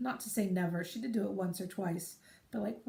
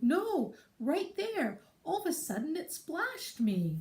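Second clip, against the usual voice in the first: put-on toddler voice; 9 semitones higher, slower than usual. Mother quoting daughter blaming the floor, high and insistent. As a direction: insist like an offended small child. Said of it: "She'd be like".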